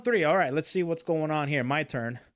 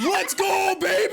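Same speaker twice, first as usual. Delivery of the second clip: high, loud victory shout; triumphant; 5 dB louder, slower than usual. Real, not acted. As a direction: yell it out, elated. Celebrating a correct guess.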